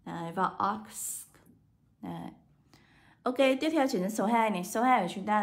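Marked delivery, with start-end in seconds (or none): 0.7-1.3 ox with a hissed final s; slow ox, ending in a voiceless s; final S sound. Shown as a demonstration. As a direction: let the end of ox hiss. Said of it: "được đọc cho cô là âm S".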